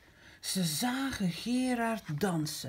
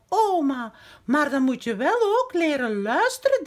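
plain reading voice

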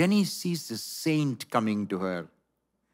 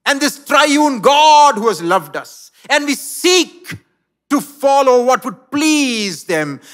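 rising sermon climax; preaching at full force; 11 semitones higher, 11 dB louder than usual. Acted. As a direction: lift the congregation with fervour.

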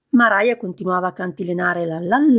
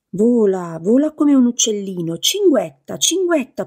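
shrill voice; 3 semitones higher than usual. Acted. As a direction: trill it out like a mocking bird call. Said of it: "disse con voce stridula".

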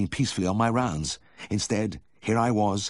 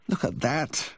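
high-pitched moaning complaint; moaned; 9 semitones higher, slower than usual. A narrator's reading. Vet seeing straw in the wound, moaned. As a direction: moan it in weary dismay. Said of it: "I moaned".

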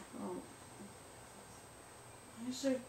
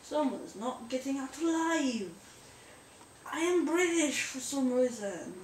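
higher mock British-French accent; hammed-up British-French accent; bad accent, British turned French; 4 semitones higher, 4 dB louder than usual. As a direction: put on a botched British accent sliding into French. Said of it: "sounding more like a very racist French accent".

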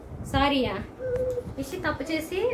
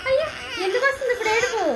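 mock pity; 9 semitones higher, faster than usual. Real, not acted. Mother mock-sympathizes with crying child.

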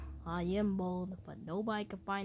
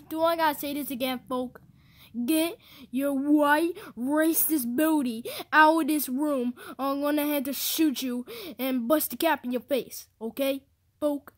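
angry threat; 4 semitones higher, 8 dB louder than usual. Acted. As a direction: drive him out of the room. Threatening friend to get out.

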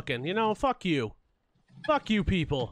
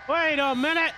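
higher, louder "Wait a minute!" with a rise on "minute"; excited; 7 semitones higher, 5 dB louder than usual. Real, not acted. Player spotting something, calling out in alarm. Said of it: "repeated exclamation".

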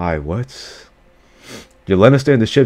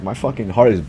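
usual speaking voice

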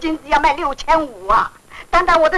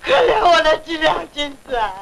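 tearful wailing. Acted. Mourning gold swallowed by the landlord, tearful wailing. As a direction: wail and sob over the loss. Woman laments her lost gold.

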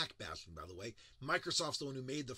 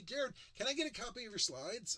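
mock eager request; 11 semitones higher, 5 dB louder than usual. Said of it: "when people say to me".

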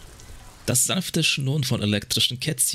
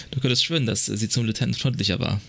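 ordinary talk

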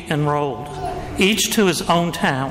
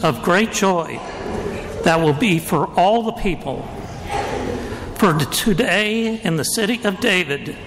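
Gospel reading. Acted. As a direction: proclaim it like a liturgical reading.

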